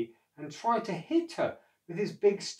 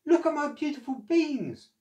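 put-on higher, proud young boy's voice; excited boy; 12 semitones higher, 6 dB louder than usual. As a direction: show off the treasure like a child. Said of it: "he called".

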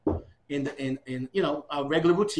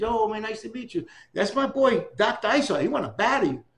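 higher, louder hyped-up pitch; excited intro; 6 semitones higher, 4 dB louder than usual. Retold or imitated. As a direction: pump up the battle. Kango proposes the battle.

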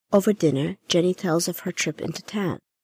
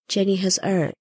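usual speaking voice

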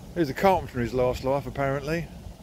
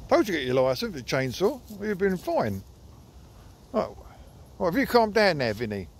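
ordinary talk, nothing marked

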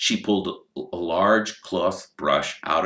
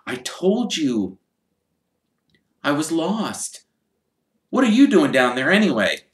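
put-on higher, boyish and a bit defensive; young Harry voice; 11 semitones higher, 5 dB louder than usual. Harry explaining to Hagrid he got lost, in a higher boyish voice. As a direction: insist you were only lost.

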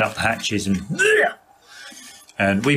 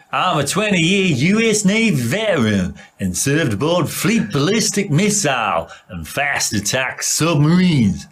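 put-on American accent; 3 semitones higher than usual. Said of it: "But I've got a better American accent than you".

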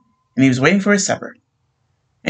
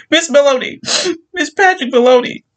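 much higher, louder panicked answer; frantic; 13 semitones higher, 4 dB louder than usual. Acted. Wife giving her name to police, frantic high voice. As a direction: blurt her name in distress.

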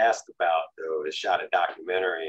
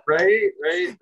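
laughing; 10 semitones higher, 7 dB louder than usual. Real, not acted. Still laughing after joke.

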